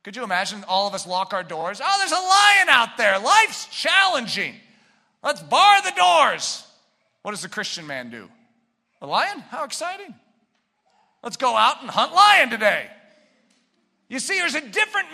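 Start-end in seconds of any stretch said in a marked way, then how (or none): 1.8-6.6 loud, high, slow mock panic; mock alarm. Preacher acting the sluggard scared of a lion. 9.0-12.9 eager hunter. Acted.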